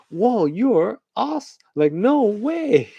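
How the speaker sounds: slow, very high, drawn-out awe; mock amazement. Onlookers reacting to slicing and dicing, mock amazement. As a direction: stretch it high in hammed-up wonder. Acted crowd reaction shot.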